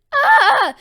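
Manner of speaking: very high, loud fan shriek; starstruck scream. Acted. Deku wakes to All Might's face, screaming. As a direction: shriek at seeing your idol. Deku sees his idol.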